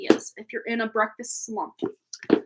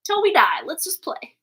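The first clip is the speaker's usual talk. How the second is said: voicing the dog; 6 semitones higher than usual. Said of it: "And Arthur's like".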